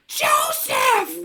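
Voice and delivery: high shrill call of a name; mom yelling. Retold or imitated. An angry mom bursting in on her gamer son, yelling. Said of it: "we just hear".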